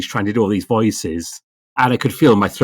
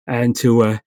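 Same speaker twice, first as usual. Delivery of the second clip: ordinary delivery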